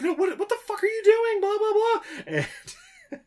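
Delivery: very high, dragged-out shrieking; mock panicked shriek. Katie panicking after the stabbing, a high mock shriek. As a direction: squeal in hammed-up horror. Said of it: "And then she's like".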